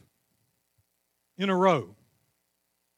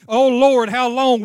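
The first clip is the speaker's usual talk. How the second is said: high, loud pleading cry; crying out to God; 6 semitones higher, 8 dB louder, slower than usual. Retold or imitated. Preacher voicing a weary believer's prayer, loud cry. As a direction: call out to heaven in distress. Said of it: "Why is it every time".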